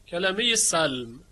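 ordinary talk, nothing marked

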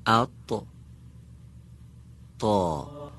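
«تا» said thick and full, pulled to the back of the mouth; heavy backed t in «ات، تا»; emphatic ta. Shown as a demonstration. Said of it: "دقت کنید". (طای درشت و پرحجم از عقب دهان)

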